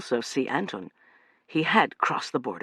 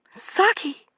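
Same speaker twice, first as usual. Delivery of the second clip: gasped name; 4 dB louder than usual. A narrator's reading. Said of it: "gasped Amanda".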